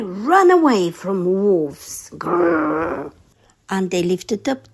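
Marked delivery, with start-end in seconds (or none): 2.1-3.1 low growl; wolf growl. Acted. Narrator to children, growling like a wolf. Wolves in story.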